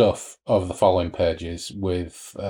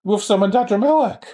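much higher, louder panicky question; panicked Dan; 11 semitones higher, 3 dB louder than usual. Retold or imitated. Dan, a helpless passenger, asks in higher-pitched panic. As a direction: squeak it out in alarm. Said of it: "And Dan's going like".

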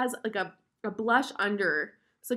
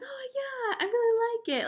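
much higher, squeaky fake-polite "I really like it"; forced enthusiasm; 14 semitones higher than usual. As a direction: sell a liking you don't feel, pitched way up. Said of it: "I just kept on saying".

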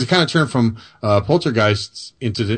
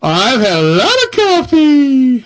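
1 hyper coffee; 14 semitones higher, 5 dB louder, slower than usual. Real, not acted. Admits too much coffee.

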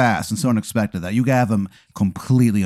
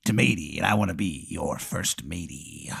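pirate tomato; 5 semitones lower, 10 dB quieter than usual. Acted. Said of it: "he's a pirate".

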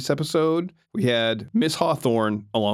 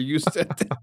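accusatory. Retold or imitated. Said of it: "this weird accusatory".

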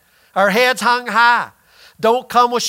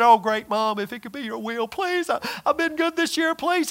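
mock timid pleading; 6 dB quieter than usual. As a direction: beg Mom like a nervous kid. Son pleading timidly, mocked.

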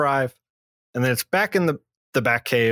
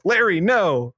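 higher, hammed-up motherly cry; mock alarmed mom; 5 semitones higher than usual. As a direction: call out as a panicked mother, playfully. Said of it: "the mom's like".

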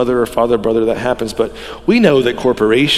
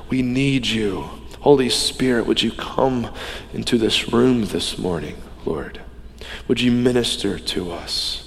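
soft, hushed pastoral prayer; 2 semitones lower, 5 dB quieter than usual. Acted. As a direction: pray quietly and earnestly. Opening prayer before sermon.

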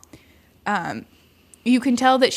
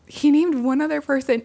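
normal talking voice

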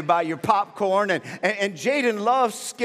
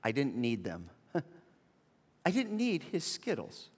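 normal talking voice

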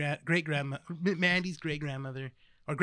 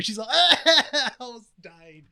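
ordinary delivery

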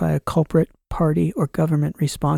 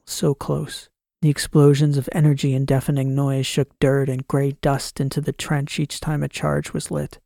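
plain reading voice

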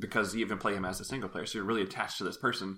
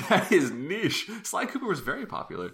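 laughing; 10 semitones higher, 3 dB louder than usual. Real, not acted. Echoing while laughing.

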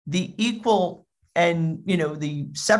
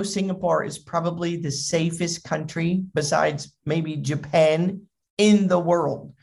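ordinary delivery

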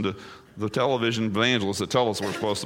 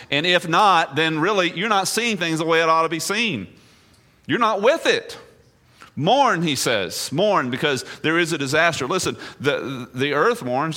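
raised, higher pulpit voice pressing the point; preaching at full force; 6 semitones higher, 4 dB louder than usual. Acted. Pastor pressing his flock to mourn, preaching loudly. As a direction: drive it home to the congregation.

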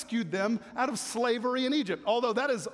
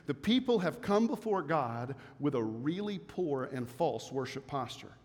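normal talking voice

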